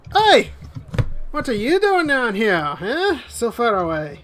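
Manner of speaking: put-on high, lilting try at a Spanish accent; attempted Spanish accent. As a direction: challenge the strangers in a shaky put-on Spanish accent. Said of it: "I went from Spanish to Southern".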